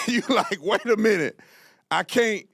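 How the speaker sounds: usual speaking voice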